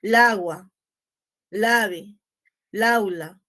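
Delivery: lagua, lave, laula slurred into single words; run-together lagua. Shown as a demonstration. Teacher demonstrating the cacophony, la and noun run together. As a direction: blur la and the noun into one word, lagua.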